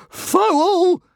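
very high, loud, outraged cry; bellowing. A narrator's reading. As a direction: yell it out as an outraged accusation. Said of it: "bellowed Fishlegs".

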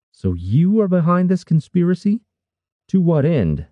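high-pitched, insistent questioning; demanding. A narrator's reading. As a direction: demand an answer, pitch pushed well up. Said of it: "Brannis demanded".

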